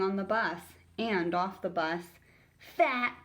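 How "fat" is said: short, raised jeer; jeering bully. Acted. Bully jeering at classmates, raised voice. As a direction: toss the word out as a jeer. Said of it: "he jeered".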